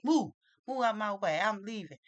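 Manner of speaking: put-on upset child's order. Upset boy shoves past the line, mock angry order. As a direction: push through like an upset little boy.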